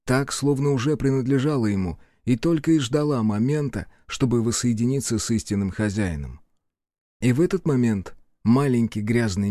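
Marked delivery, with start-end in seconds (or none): none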